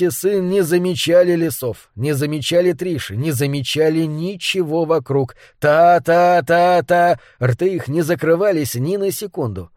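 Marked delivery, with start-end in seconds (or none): 5.6-7.2 slow rattling chatter sound; mock chatter. A narrator's reading. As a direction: rattle off like nagging mouths. Mimicking endless chatter.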